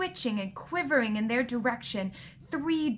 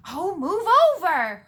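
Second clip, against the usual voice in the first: loud, high, exasperated snarl; 7 semitones higher, 9 dB louder than usual. A narrator's reading. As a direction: snarl it loudly, out of patience. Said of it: "Hermione snarled".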